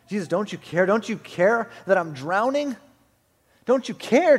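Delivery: loud, rising cry for help; acted cry of someone in a storm; desperate plea. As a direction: plead urgently as if drowning. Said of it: "we're all asking in our storm".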